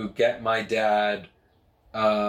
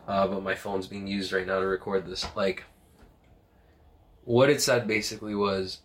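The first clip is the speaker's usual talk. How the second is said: ordinary talk, nothing marked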